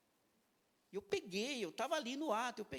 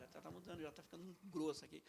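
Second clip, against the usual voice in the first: voice thickening; 4 semitones lower, 6 dB quieter than usual. Real, not acted. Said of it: "Já tá mudando o tom de voz já".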